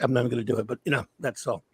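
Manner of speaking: ordinary delivery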